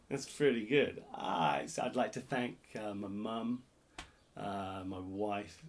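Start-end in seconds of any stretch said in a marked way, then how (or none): 1.1-5.6 soft low mock acceptance speech; understated award thanks. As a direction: thank them like accepting an Oscar, hushed.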